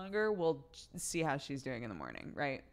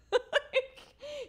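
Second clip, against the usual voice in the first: high burst of laughter; 4 dB louder than usual. Real, not acted. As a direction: break into laughter. Laughs at husband's reaction.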